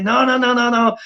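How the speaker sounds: hammed-up protest at a guru in a t-shirt; mock outraged purists. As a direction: play the scandalised critic flipping out. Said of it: "then they flip out and they say".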